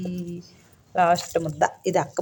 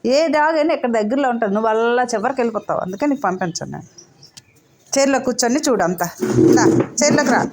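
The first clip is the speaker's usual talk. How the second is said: loud, higher warning to the pet; loud coaxing; 4 semitones higher, 6 dB louder than usual. Acted. Owner to her pet, loud warning then calling. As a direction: call the pet over firmly.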